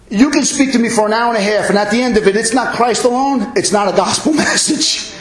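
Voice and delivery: high, rapid-fire sermon build; rising into impassioned pulpit insistence; full-force preaching. Real, not acted. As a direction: preach it relentlessly, pitched high.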